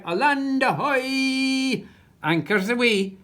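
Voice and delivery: hammed-up sea captain shout; pirate yell. A narrator's reading. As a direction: call across the deck like a captain. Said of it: "yelled Captain Shugle".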